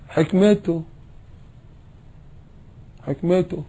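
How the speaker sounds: high, sighing grudging "حكمته"; grumbling pretend resignation; grudging mock acceptance. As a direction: say it resentfully, a touch high. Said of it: "ويزق كده".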